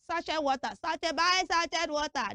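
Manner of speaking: mock hawker calling out sachet water; street vendor's call. Imitates street seller calling out.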